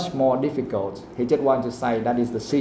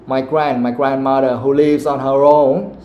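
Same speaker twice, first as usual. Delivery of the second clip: "own" stretched and loud; emphatic stress; 5 dB louder than usual. Shown as a demonstration. Teacher modelling grandmother's living alone, heavy stress on "own". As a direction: build to a loud, held "own". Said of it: "he want to stress that".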